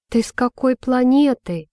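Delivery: narrated testy question; irritated retort. A narrator's reading. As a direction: snap back in mild exasperation. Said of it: "огрызнулась Имоджин".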